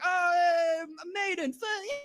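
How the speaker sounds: very high yelled hype, trailing off; drunk metal cheer. Retold or imitated. Drunk concert excitement.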